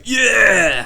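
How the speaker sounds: high, loud, drawn-out roar; hyped-up crowd shout; metal fan's cheer. Retold or imitated. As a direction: imitate an overjoyed gig-goer yelling. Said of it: "sound guy ... is just like".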